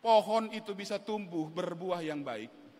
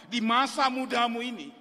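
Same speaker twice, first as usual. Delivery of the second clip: ordinary talk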